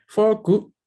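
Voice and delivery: Foku, Japanese style; fo-ku as a katakana word; Japanese loanword. Shown as a demonstration.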